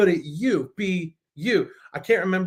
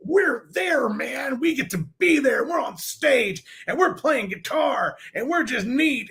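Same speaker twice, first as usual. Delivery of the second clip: excited; 9 semitones higher than usual. Retold or imitated. Excited retelling of being on stage.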